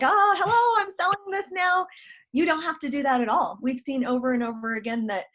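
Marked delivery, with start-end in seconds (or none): 0.0-1.8 higher, mock-cheery sales pitch; awkward pushy seller. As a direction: blurt it out like a clumsy saleswoman. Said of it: "Like, do I just say".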